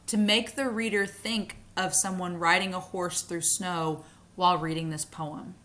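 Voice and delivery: usual speaking voice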